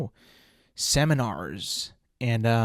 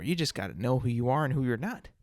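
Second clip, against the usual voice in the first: usual speaking voice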